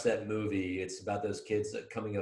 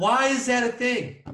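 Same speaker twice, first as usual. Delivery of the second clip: indignant disbelief; 13 semitones higher, 7 dB louder than usual. Real, not acted. Indignant at school rule.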